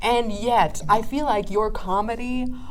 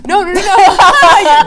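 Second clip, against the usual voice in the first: excited protest; 9 semitones higher, 14 dB louder, much slower than usual. Real, not acted. Host protests laughing.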